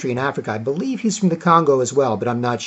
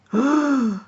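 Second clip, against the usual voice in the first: high, drawn-out scandalized gasp; mock shocked gasp; 11 semitones higher than usual. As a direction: gasp as if appalled, hammed up. Acting out a scandalized listener.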